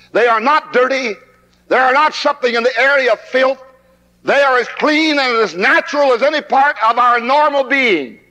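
ordinary talk, nothing marked

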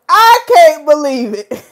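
gushing, star-struck disbelief; stunned disbelief. Real, not acted. Host thrilled a famous guest joined her live stream, stunned.

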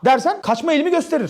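ordinary talk

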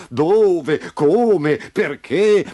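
loud, high, dim-witted questioning; stupid questions. A narrator's reading. Uncomprehending father questioning Memo, stupidly puzzled. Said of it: "Continuava a fare domande stupide".

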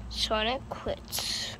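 slow hushed whisper; whispered narration. Pretend. Narrating the Sonic plushie quitting, slow dejected whisper. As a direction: whisper it slowly like a sad caption.

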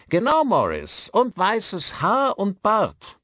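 high, loud shouted confirmation; calling loudly. A narrator's reading. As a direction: shout it so the old man hears. Calling to a deaf old man.

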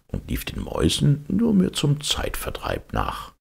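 ordinary delivery

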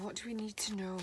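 soft whispered question into the quiet. Real, not acted. Reader whispering a question to the Divine Masculine. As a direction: whisper the question as if to unseen guides.

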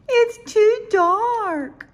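put-on very high, slow, loud childlike wail; crying out. A narrator's reading. Little Owl complains to Mommy about the dark, wailing. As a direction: stretch out a small owl's frightened complaint. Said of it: "Little Owl cried".

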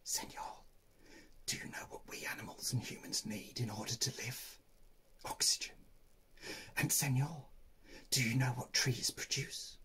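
breathy whispered porcupine voices. Acted. Porcupines whispering to the sleeping man, hushed. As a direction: whisper it close to his ear. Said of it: "whispered to the man".

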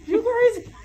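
high squeaky laughing question. Real, not acted. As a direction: laugh through the words. Laughing at friend's narrator voice.

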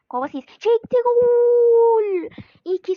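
high, loud goal yell; goal shout. Real, not acted. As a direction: scream gol like a commentator. Goal scored in the game.

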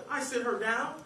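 usual speaking voice

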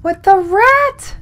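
high, loud, drawn-out disbelief; appalled. Real, not acted. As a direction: let the pitch leap up in real shock. Reacts to rat in horror game.

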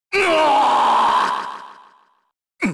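long, high yell of defeat; defeat scream. Acted. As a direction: cry out long as if struck down. Game character's cry when defeated. (長く叫ぶ断末魔)

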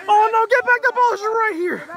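very high, urgent call; alarmed urging. Real, not acted. Commentator warning a runner as the ball arrives, alarmed. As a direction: warn the runner back.

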